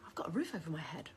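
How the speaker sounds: quick, breathy whisper of wonder; hushed, fast whisper; whispered gratitude. Pretend. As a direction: marvel at it under your breath. Counting blessings aloud.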